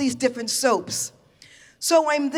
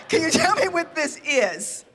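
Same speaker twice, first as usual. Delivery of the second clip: a higher, overly polite ask; diplomacy voice; 4 semitones higher than usual. Retold or imitated. Asking Korean hosts about the dish, mock polite. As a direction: put on the sweetest guest voice. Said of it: "with my best diplomacy voice".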